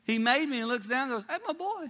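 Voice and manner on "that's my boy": very high, proud squeal; proud father. Acted. God looking down at the one he made, proud father.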